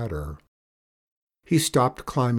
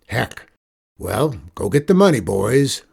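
lightly voiced outlaw boss, louder; 7 dB louder than usual. Outlaw sending his boys for the money, bossy. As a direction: order the gang about.

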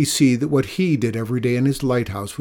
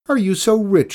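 high, loud mocking jab; taunting; 10 semitones higher, 6 dB louder than usual. Old woman taunting the old man, high mocking jab. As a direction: needle him with scorn. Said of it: "she taunted".

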